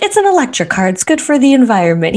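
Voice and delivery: high, quick indignant reply; a touch of indignant whine; defensive protest. Retold or imitated. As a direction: defend the car, peeved. She defends her car.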